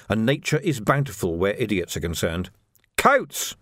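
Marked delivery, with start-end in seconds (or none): 3.0-3.6 high, sharply pitched call; yell. A narrator's reading. Vimes calling after Coates as he walks away, yelling. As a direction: call out to stop him. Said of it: "he yelled".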